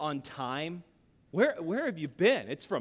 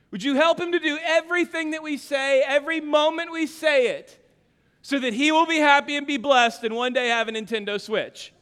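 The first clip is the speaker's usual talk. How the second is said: mock bedtime prayer; 6 semitones higher, 5 dB louder than usual. Dad praying over his son at night, hammed-up pleading prayer. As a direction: ham up an earnest dad praying.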